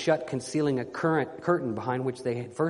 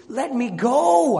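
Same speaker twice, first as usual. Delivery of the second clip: high, loud, drawn-out cry; rising shriek; 10 semitones higher, 8 dB louder, much slower than usual. A narrator's reading. Frenzied prisoner demanding release, shrieked. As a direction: shriek it, stretching the last word. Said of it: "rising into a shriek".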